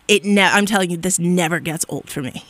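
normal talking voice

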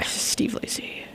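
slow, voiceless whisper; whispered aside. Real, not acted. Host whispers an example of TikTok fame, whispered aside. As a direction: slip the name in under your breath. Aside naming the artist meant.

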